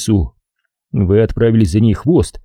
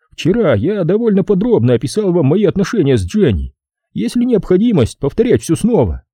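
higher, measured character voice; Rand, courteous; 9 semitones higher than usual. Acted. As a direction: ask formally, with the pitch lifted. (вежливый Рэнд, высоким голосом)

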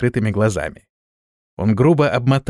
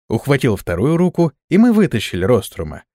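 ordinary delivery, nothing marked

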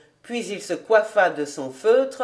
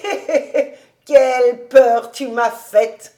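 laughing; 3 semitones higher, 6 dB louder than usual. A narrator's reading. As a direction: laugh off the fright. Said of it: "Il riait".

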